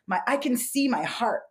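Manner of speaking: normal talking voice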